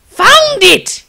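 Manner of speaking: high, loud burst of excitement; excited triumphant shout; enthusiastic loud. A narrator's reading. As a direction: blurt it out thrilled. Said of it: "he attested in an enthusiastically loud tone".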